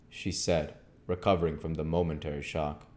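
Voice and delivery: ordinary delivery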